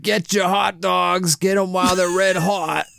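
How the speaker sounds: a street vendor's put-on New York accent. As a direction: hawk hot dogs in a thick New York accent. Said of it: "in the weirdest New York accent ever".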